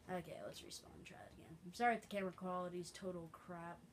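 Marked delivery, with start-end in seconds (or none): none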